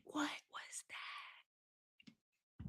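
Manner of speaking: hushed, drawn-out whisper; startled whisper. Real, not acted. Host alone reacting to a sudden pop, startled whisper.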